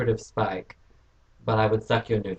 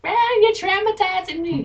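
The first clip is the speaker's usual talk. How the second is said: mock whiny guy.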